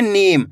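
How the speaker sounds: नीम with the ee stretched long; नीम, long ee before the m; drawn-out long vowel. Shown as a demonstration. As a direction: pull out the ee in नीम. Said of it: "इसी तरीके से पढ़ना आपको खींच के".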